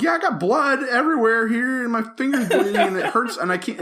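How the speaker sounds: high, hammed-up wail with laughter; pained whining. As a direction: act out a hurt, self-pitying complaint. Acted pained complaint to wife.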